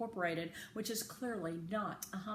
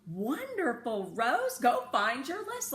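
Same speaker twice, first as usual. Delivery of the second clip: slow, higher, louder praise; excited uncle; 5 semitones higher, 7 dB louder, slower than usual. A narrator's reading. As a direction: savour the good news. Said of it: "Uncle Weldon gets excited about homonyms too".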